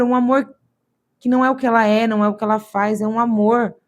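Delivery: normal talking voice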